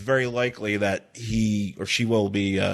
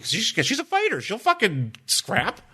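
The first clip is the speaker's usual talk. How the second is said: higher, excited pitching of an idea; animated higher pitch; 8 semitones higher than usual. Retold or imitated. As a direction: sell the idea with rising glee. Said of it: "And I'm like".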